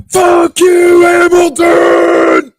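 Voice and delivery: full-blast, very high mock-furious screech; imitated angry scream. Imitating angry Jezzy screaming at Hamilton, furious screech. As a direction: scream it drawn out, imitating someone enraged. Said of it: "I had Jezzy so angry".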